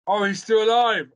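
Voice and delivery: high, loud startled oh; surprised realization. Real, not acted. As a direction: caught out, genuinely surprised. Realising his mistake.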